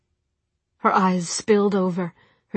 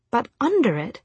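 ordinary delivery, nothing marked

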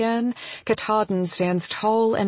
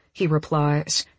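plain reading voice

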